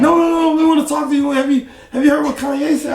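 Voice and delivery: excited caller. Retold or imitated. Magazine caller telling him about [name], high and eager. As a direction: gush like a reporter with big news.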